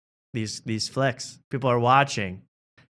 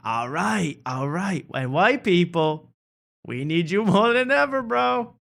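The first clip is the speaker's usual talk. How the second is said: lightly voiced hype comic, higher; comedian crowd work; 6 semitones higher, slower than usual. Cat Williams addresses the ladies, lightly voiced hype. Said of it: "And he goes".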